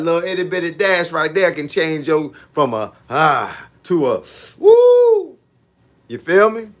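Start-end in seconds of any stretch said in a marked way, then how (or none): 3.8-5.3 a long, much higher falsetto woo, stretched slow; high yelp woo. Pretend. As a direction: yelp it out like the cold just bit you. Acting out the shock of the cold.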